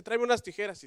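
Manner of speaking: ordinary talk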